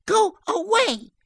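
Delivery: very high, slow, loud shout; panicked child's cry. Acted. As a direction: cry it out as a terrified little girl. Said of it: "threw Joan into a sudden panic".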